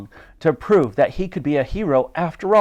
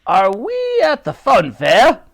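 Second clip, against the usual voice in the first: loud, high, drawn-out indignant question; scoffing Brigadier; 10 semitones higher, 6 dB louder than usual. A narrator's reading. The Brigadier objects to another go, loud scoffing. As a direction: scoff like an old officer.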